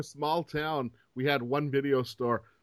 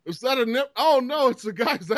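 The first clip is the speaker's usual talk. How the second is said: mock dismay; 7 semitones higher than usual. Discovering the scrambled picture is a guy, mock shock and dismay. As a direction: recoil at the reveal.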